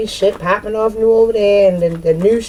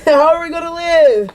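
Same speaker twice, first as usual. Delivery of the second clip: high, laughing mock panic; mock panic with laughter; 9 semitones higher, 4 dB louder than usual. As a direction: ask how they'll live, cracking up. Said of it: "I'm hearing this shit in my head".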